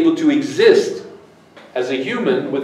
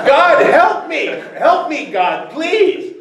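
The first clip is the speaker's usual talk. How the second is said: high-pitched mock begging for rescue; desperate pleading, acted; 14 semitones higher than usual. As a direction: plead with God for dear life, playfully overacted. Acted plea of hanging man.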